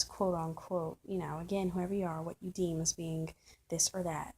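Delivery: ordinary talk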